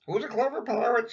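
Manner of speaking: high parrot copying the question. Acted. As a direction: squawk it back like a parrot. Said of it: "says Polly".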